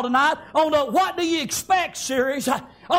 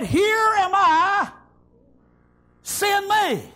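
high, long-drawn ringing answer; eager volunteering; 3 semitones higher, much slower than usual. Acted. Isaiah answering the Lord's call, slow high-pitched declaration. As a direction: call it out slowly, stretching each word.

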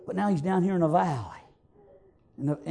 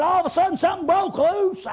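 high, loud, shouted preaching; preaching at full force; 11 semitones higher, 5 dB louder than usual. Acted. A country preacher telling the rain story to his church, shouting.

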